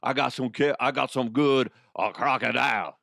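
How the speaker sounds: badly faked Cajun accent, a bit loud. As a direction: hawk your wares in a clumsy Cajun accent. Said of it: "the guy has a Cajun accent".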